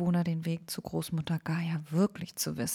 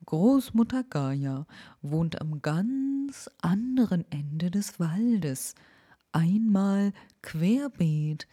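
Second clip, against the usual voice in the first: slow, whispery owl giving directions; the owl drawing the long route; slow breathy owl voice with a hoot; slower than usual. Acted.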